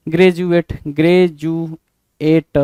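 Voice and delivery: very slow, drawn-out graduate; slow dictation. Shown as a demonstration. Teacher dictating the word to students, very slow. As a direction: stretch graduate out syllable by syllable. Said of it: "graduate लिखना जरा".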